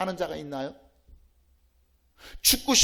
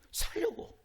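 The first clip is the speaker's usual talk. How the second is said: whisper; 11 semitones higher, 11 dB quieter than usual. Acted.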